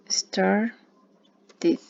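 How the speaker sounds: "Started" split slowly into "star... tid"; E sounded as I. Shown as a demonstration. Teacher writing it out for learners, "Started" in two separated syllables. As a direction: break "Started" before the final "tid", with a pause. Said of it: "esa E nos suena como si fuera una I".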